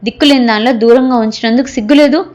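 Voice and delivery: ordinary delivery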